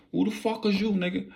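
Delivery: high, mock demanding shout; high-pitched imitation of a gunman; intruder demanding. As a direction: bark it like an armed intruder. Armed intruder yelling.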